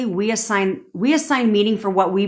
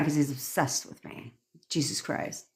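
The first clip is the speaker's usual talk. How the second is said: whispered, satisfied aside; smug whisper; 3 semitones lower, 12 dB quieter than usual. Pretend. Girl gloating that he's obsessed, whispered. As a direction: whisper it like a smug little secret.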